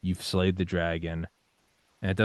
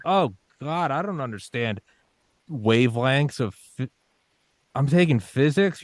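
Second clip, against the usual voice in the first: higher, louder overwhelmed groan; mock despair; 6 semitones higher, 4 dB louder than usual. Overwhelmed student stuck on physics wavelengths, higher, louder mock despair. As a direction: whine it like a lost pre-med student.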